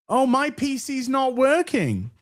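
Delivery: high, whiny mock excuse; put-on high voice, badly faked surprise; mocking imitation. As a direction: mimic someone feigning innocence. Mockingly acts out Mauler's excuse.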